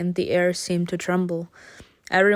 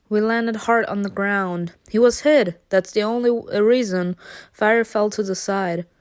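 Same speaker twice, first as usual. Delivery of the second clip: ordinary talk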